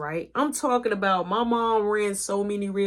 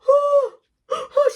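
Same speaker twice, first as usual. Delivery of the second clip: high loud yelp of pain; mock cry; 5 dB louder than usual. Son crying out after mom's slap, high yelp. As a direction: yelp like you just got smacked.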